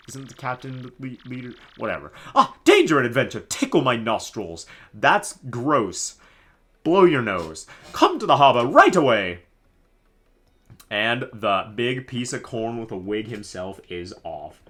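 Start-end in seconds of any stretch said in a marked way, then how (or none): 2.3-9.4 grand, high theatrical nobleman voice; pompous Flavio. Acted. Streamer voicing Flavio to the player, hammy pompous. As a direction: ham up the vain pirate-loving noble.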